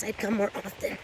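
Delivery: ordinary delivery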